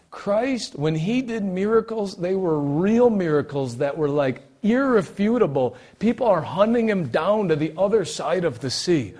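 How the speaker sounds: loud, high, awestruck proclamation; fired-up preaching. Real, not acted. Preaches at full force.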